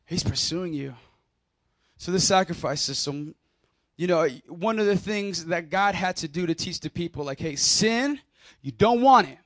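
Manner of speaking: ordinary delivery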